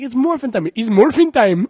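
imitating a Red Ranger in a heavy accent; mock Indian accent. Comedian mocks a Power Ranger with a high Indian accent. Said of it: "And he's like".

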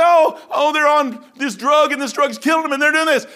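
high, loud hand-wringing voice; a hammed-up alarmed gossip; mock fretting people. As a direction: mimic worried church folk, exaggerated.

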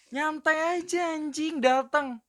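very high, stretched protest; exasperated chill-out. Acted. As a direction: tell them to relax in a high, drawn-out mock whine. Acting out telling fighters to chill.

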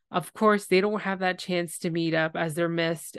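normal talking voice

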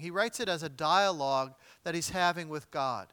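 normal talking voice

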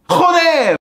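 high, loud frustrated shout; angry curse. Acted. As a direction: yell it at yourself. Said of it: "swears at slipping into Spanish".

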